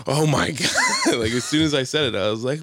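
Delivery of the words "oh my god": stretched, laughing exclamation; mock shocked laugh through the words; laughing oh my god. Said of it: "he was just like".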